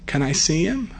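put-on small, soft child's question; little daughter. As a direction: imitate a child timidly asking. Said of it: "my daughter says".

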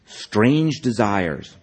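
drawn-out, projected title reading; title announcement. A narrator's reading. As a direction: draw out the title loudly and grandly.

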